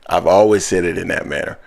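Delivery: normal talking voice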